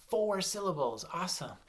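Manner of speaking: ordinary delivery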